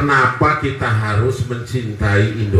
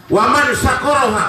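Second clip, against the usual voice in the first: raised Arabic quote; 11 semitones higher than usual. Acted.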